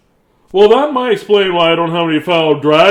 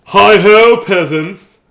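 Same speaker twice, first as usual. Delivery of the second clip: knight voice; 3 semitones higher, 6 dB louder, much slower than usual. Acted. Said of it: "I am King Knightly".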